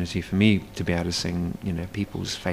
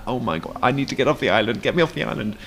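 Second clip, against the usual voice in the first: mock desperation; 4 semitones higher, faster than usual. Mock-desperate island cabin fever.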